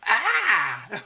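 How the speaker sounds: loud, high mock shriek of panic; startled scream. Partner overwhelmed by the upheaval, loud high mock shriek. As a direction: shriek in sudden alarm. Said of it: "the other party is like".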